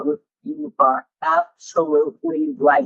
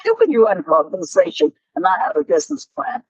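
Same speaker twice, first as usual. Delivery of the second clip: quick, disbelieving rush; fast incredulous; 2 semitones higher, faster than usual. Retold or imitated. The visitor asking how there is no plan, fast and incredulous. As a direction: spill out the words in disbelief. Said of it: "incredulous visitor".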